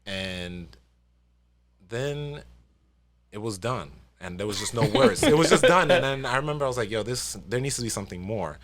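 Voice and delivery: usual speaking voice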